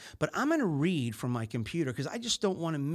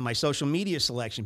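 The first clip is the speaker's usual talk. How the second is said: ordinary delivery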